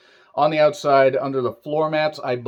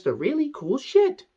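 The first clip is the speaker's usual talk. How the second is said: put-on baby talk to cat; 14 semitones higher than usual. Talking to his cat.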